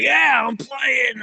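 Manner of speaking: high, slow, whooping yell; drunkenly yelling to join the volleyball game, excited; excited drunk shout. Retold or imitated. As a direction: cheer yourself into the game.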